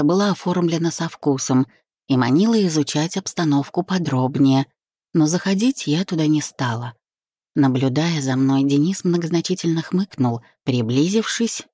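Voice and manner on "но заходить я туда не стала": usual speaking voice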